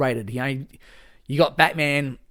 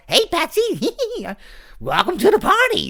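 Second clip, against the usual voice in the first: much higher villain voice with a giggle in the middle; gleeful, much higher, louder clown greeting; homemade Joker; 6 dB louder than usual. Retold or imitated. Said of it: "I just made up my own Joker".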